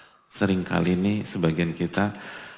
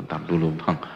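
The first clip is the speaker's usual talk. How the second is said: lazy brush-off; 5 semitones lower than usual. Retold or imitated. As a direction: let it trail off lazily. Wife brushing off husband.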